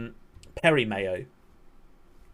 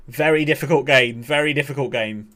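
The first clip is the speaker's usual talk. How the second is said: normal talking voice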